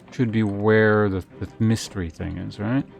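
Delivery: normal talking voice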